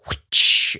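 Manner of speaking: breathy whoosh, loud and sudden; mock sound effect of a whip-pan. Imitating a camera whoosh effect.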